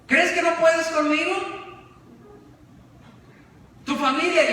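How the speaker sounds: provoke him with rising anger; young challenger furiously taunting the ruler; angry young man. Acted. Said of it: "El joven se enoja y dice".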